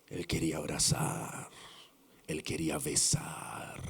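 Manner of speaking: low whispered yearning. Acted. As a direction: breathe the longing out in a whisper. Preacher voicing son's longing.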